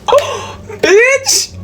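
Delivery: high, shocked gasping "Oh, bitch!"; shocked shriek. Retold or imitated. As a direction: squeal it like you can't believe the text. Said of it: "And I was all like".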